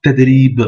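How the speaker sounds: "ted, ri, b" with no vowel after b; blocked last consonant. Shown as a demonstration. Teacher repeating the rule, word ending blocked on b. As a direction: hold "ri", then close on a bare b. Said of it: "On bloque la dernière consonne".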